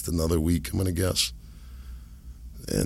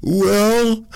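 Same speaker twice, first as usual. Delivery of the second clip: high, loud, drawn-out doubter voice; high-pitched mock head-scratcher; puzzled skeptic; 14 semitones higher, 8 dB louder than usual. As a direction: put on a high voice for a baffled objector. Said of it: "they'd be, like, scratching their heads".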